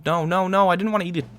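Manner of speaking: quick alarmed protest; panicked no. Real, not acted. Gamer panicking about eating the totem, flustered.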